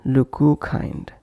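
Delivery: slow LOOK KIND with an "oo" between; extra vowel inserted. Shown as a demonstration. Teacher demonstrating a wrong pronunciation, extra vowel inserted. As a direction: stretch an "oo" after "look", as in "looku kind". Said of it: "avoid bringing any other sound between".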